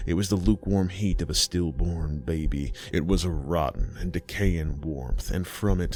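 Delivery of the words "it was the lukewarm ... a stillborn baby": usual speaking voice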